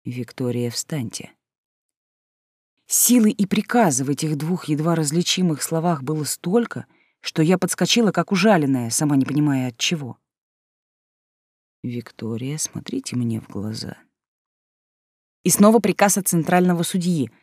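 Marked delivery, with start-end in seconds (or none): 0.1-1.3 much softer, hushed command; soft rustling whisper. A narrator's reading. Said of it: "тихо-тихо прошелестел". 11.8-14.0 much softer, low command; quiet command. A narrator's reading. Judge's quiet command continues.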